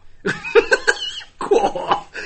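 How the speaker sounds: high, loud burst of laughter; real cackle breaking out. Real, not acted. Reacting to the friend's joke, loud high laugh.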